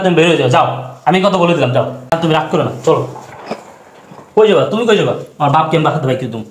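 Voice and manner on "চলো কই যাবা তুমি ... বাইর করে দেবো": ordinary talk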